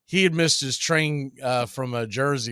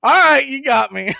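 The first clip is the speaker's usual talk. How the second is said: much higher, louder laughing reply; laughing concession; 10 semitones higher, 10 dB louder than usual. Retold or imitated. Mets fan admitting defeat, laughing loudly. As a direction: give in through a laugh. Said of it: "and he goes".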